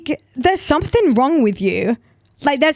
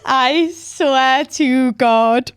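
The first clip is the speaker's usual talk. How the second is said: stunned disbelief; 2 semitones higher, much slower than usual. Real, not acted. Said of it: "disbelief after reading".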